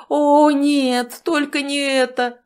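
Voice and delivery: loud high despairing cry; mild old-man panic; horrified cry. Acted. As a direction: cry out in horror. Old man realises the disaster.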